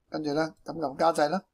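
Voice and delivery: ordinary talk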